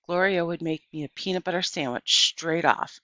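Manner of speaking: plain reading voice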